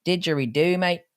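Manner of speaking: a bad, lightly done Australian accent; mock Australian with a rising lilt on Didgeridoo; hammed-up Australian accent, not quite landing. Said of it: "That's the worst Australian".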